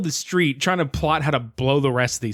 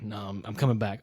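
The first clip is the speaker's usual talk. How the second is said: soft, low reversal; quiet, deflated change of mind; mock backtracking; 5 semitones lower, 8 dB quieter than usual. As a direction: sheepishly take it back under your breath. Mocking Rox's flip-flopping, alternating takes.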